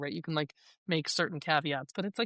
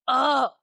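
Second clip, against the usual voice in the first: loud, higher exasperated "Ugh"; frustrated groan; 8 semitones higher, 8 dB louder than usual. Retold or imitated. Teen groaning at dad over loud music, exasperated. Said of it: "And you'd just be like".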